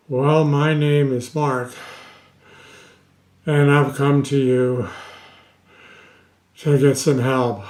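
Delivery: a gasp of air before each phrase; imitating deep-breath speech. Shown as a demonstration. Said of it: "they sound like this".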